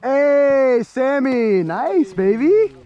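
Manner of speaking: high, loud hunting cheer; excited cheering. Real, not acted. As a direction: whoop for a good shot. Cheering a shot.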